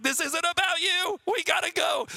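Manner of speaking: high, loud panicked yell; mock panicked dad yelling; frantic shout. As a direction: shout it like a dad bleeding and rushing out. Panicked dad with cut finger.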